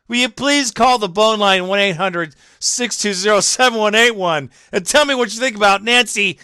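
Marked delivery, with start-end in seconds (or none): none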